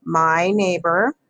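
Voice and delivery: slow, drawn-out dictation; dictating. Shown as a demonstration. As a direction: spell it out as you write. Writing sentence while saying it.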